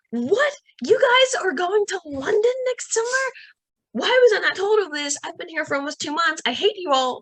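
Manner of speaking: high, loud indignant whine; playful fake fury at family; mock outrage. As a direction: play up being left out, hammed. Retold outburst at cousins.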